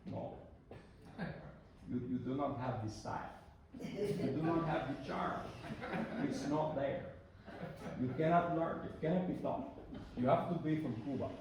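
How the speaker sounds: imitating a stern Cuban teacher's accent, lightly; mock the teacher's verdict in a hint of a Cuban accent; lightly put-on Cuban accent, half amused. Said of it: "in his very distinct".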